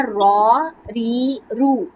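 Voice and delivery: را and رو thick, ری thin, all very slow. Shown as a demonstration. Teacher recapping the ra rule slowly for a child. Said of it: "تو یہ آپ نے یاد رکھنا ہے".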